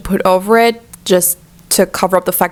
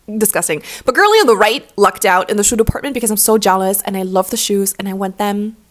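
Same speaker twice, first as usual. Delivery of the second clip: ordinary delivery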